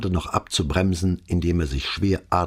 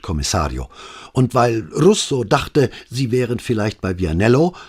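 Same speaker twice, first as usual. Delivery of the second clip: higher, louder, breathless policeman report; rattled young officer reporting to his boss; agitated sergeant; 6 semitones higher, 5 dB louder than usual. A narrator's reading. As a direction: blurt it all out in a rush of alarm. Agitated Alvise reporting call.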